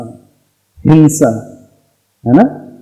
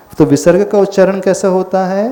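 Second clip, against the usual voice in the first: normal talking voice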